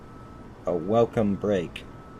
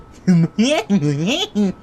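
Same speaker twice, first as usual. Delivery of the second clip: laugh; 11 semitones higher, 9 dB louder than usual. Acted. Narrator's laugh, pitch and level up.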